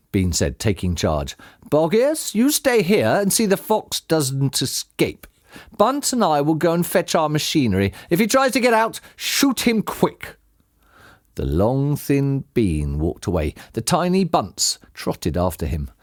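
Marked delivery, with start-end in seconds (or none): 1.7-10.4 higher-pitched, commanding character voice; farmer giving orders. Acted. Bean orders Boggis to guard the foxhole, commanding. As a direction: order your partner about, slowing on the last words.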